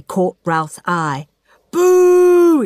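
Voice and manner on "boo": high, loud jeering boo; yelled boo. A narrator's reading. Ralph jeering at Claire with a loud high boo. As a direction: jeer at the rival candidate. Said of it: "yelled Ralph".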